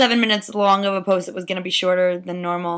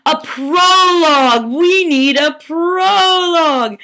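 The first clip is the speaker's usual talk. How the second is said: slow, loud, high mock actor voice; booming rustic actor discovering a word; excited Bottom; 6 semitones higher, 4 dB louder, much slower than usual. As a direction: proclaim it like a hammy player. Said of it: "So he's like".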